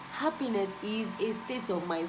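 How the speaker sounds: usual speaking voice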